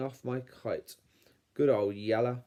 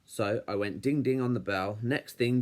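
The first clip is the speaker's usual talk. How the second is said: ordinary delivery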